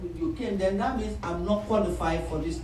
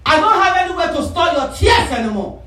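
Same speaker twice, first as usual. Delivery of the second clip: loud, raised-pitch telling-off; exasperated scolding; 7 semitones higher, 7 dB louder than usual. Acted. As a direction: scold loudly as a fed-up God. God scolding her for crying.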